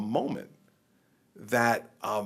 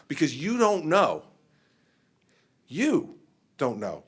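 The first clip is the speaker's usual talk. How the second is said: ordinary talk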